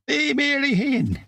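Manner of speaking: high, slow, broad Glasgow voice; Glasgow accent. Retold or imitated. Mimicking a Glasgow comedy character, broad Glasgow accent. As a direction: put on a broad Glasgow voice for the quote. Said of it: "Rhapsody Nesbitt".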